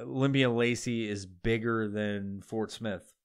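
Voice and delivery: usual speaking voice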